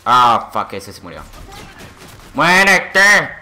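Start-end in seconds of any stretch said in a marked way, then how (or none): 2.3-3.3 shouted taunt. Real, not acted. Shouting at enemies while shooting.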